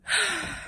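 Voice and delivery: audible, soft sigh. Real, not acted. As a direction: sigh at the damage. Showing the damage upstairs.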